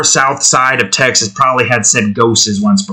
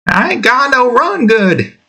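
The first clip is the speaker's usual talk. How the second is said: mock southern voice; 11 semitones higher than usual. Mock hick voice line.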